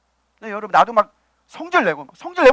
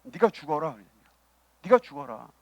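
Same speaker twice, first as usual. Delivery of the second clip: low, subdued divine command; the Lord's command; 7 semitones lower, 8 dB quieter than usual. Acted. The Lord answering the believer's anger, low and restrained. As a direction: lightly voice the Lord, lower and softer. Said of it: "주님이 우리에게 원하시는 건 뭡니까".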